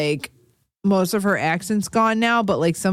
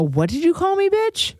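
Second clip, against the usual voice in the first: high-pitched mock indignation, rising sharply; mock affronted; 11 semitones higher than usual. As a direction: act offended, climbing to a squeak. Imagined drunk misunderstanding of Cockney speech.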